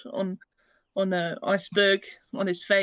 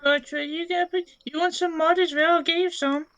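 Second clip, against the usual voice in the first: high-pitched, eager character voice; 9 semitones higher, 4 dB louder than usual. Acted. Player's character offers some mud, high character voice. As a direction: pipe up high as you offer it.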